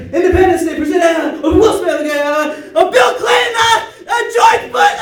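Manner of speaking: frantic high shouted names; screaming guesses. Retold or imitated. Game players screaming names at each other, panicked shout. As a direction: yell the answers in a panic. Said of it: "people start screaming the name".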